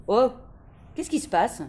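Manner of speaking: startled question with a stern edge; surprised, a bit stern. A narrator's reading. Librarian finds a hiding girl, surprised and stern. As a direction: caught off guard, a little severe. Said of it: "d'un ton surpris, un peu sévère".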